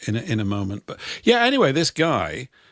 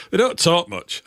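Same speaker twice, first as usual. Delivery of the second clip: higher, imitated Yorkshire accent; put-on Yorkshire voice; northern accent; 6 semitones higher than usual. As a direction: do a northern local having a moan. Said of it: "sort of northern chat".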